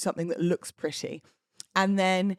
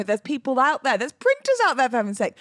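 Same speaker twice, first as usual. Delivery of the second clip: exasperated; 6 semitones higher, 5 dB louder than usual. Real, not acted. Exasperated rant about printers.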